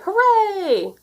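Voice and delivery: high, loud cheer; shouted hooray. A narrator's reading. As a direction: cheer it together. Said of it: "Everybody shouted".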